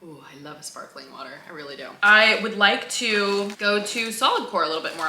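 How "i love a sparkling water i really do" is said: ordinary talk, nothing marked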